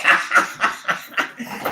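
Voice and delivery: breathy burst of laughter; quiet wheezing laugh. Real, not acted. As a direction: crack up at your own bit. Cracks up at own bit.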